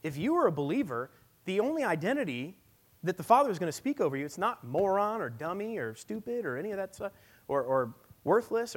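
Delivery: loud, high, impassioned preaching, slowing on the key words; full-force preaching. Acted. Pastor pleading with the congregation in a loud, impassioned preach. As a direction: preach it hard to the whole room.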